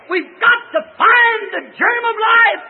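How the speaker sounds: high, slow, loud wail of a preacher; crying preaching shout. Real, not acted. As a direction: cry it out with a breaking voice. Preaching at full force.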